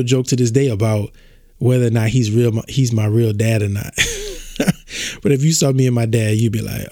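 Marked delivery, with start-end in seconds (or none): 4.0-5.2 quick high chuckle, soft; short laugh. Real, not acted. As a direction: laugh off the joke. Laughs about real-dad joke.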